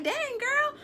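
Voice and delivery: high-pitched mock astonishment; high, drawn-out disbelief; shocked reaction. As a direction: squeal it like stunned gossip. Acts out own shocked reaction.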